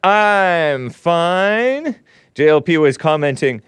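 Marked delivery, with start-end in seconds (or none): 0.0-2.0 high, drawn-out singsong hello; hammed-up greeting. Host checking in with his audience, high drawn-out greeting.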